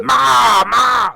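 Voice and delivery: high, very loud drawn-out yells; over-the-top shouted wrestler impression; Luna Vachon. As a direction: bellow wildly, really getting into it. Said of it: "Like, really getting into it".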